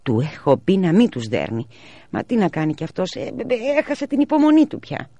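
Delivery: lightly voiced worried mother; mother's voice. A narrator's reading. (ελαφριά φωνή ανήσυχης μητέρας)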